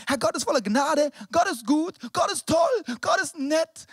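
high, loud mock enthusiasm; over-keen believer, hammed up; mock gushing believer. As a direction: gush it loudly, phrase by phrase. Voices the breezy believer's thinking.

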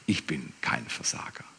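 soft unvoiced whisper; hushed whisper of God; whispered God reply. God answering Moses, whispered.

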